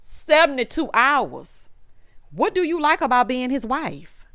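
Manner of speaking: ordinary delivery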